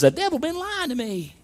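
high, soft retold astonishment; amazed realization. New convert discovering the devil's lie, high-pitched amazement. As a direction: let it leap up high in disbelief.

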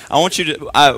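normal talking voice

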